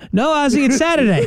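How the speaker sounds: put-on a child correcting a muddled dad; high kid voice explaining gently; mock kid answering.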